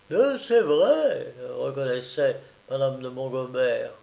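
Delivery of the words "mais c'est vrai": higher, very slow; sad concession. Acted. Mother admitting the truth, very slow and higher. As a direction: concede it with sorrow.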